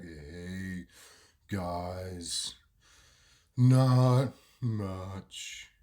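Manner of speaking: very slow, low, soft drawl; Wado's slowed speech. Retold or imitated. Slowed mate answering friends after hospital, slow low drawl. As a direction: drag each word out with long gaps. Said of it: "He's talking a lot slower than what he used to".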